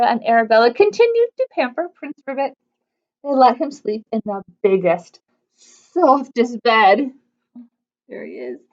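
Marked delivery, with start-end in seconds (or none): none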